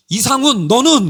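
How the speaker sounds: retold demanding roll-call question; interrogating senior pastor. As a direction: call out the next one to answer. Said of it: "목사들 한 명 한 명을 물어요".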